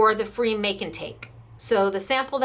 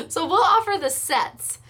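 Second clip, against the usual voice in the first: talking through a laugh; higher laughing voice; 8 semitones higher than usual. Real, not acted. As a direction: still giggling, go back to business. Laughing after calling out.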